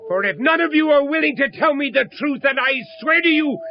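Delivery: rising, emotional challenge; angry demand. Acted. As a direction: swear an oath at her with fury. Confronting Mrs Northcote.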